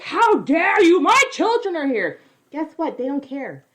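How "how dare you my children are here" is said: high, loud indignant yell; hammed-up shout of a family raided by police; mock outraged shout. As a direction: shriek it like an offended homeowner. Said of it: "they're like".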